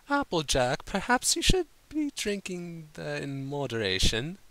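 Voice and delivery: lightly posh pony lady advising; Rarity's prim voice. A narrator's reading. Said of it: "Rarity suggested quietly".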